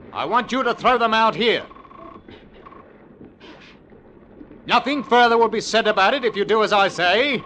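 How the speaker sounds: stern command to prisoners. Acted. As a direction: call into the hold with authority. Captain addresses prisoners in hold.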